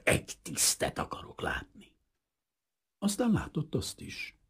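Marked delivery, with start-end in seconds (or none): 0.0-1.9 low furious whisper; injured pilot demanding an officer, teeth gritted. A narrator's reading. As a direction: demand it through clenched teeth, barely voiced. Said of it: "csikorgatta a fogát".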